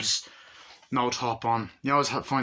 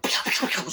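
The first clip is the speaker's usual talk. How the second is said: voiced gunshots; 9 semitones higher than usual. Imitating gunfire in scene.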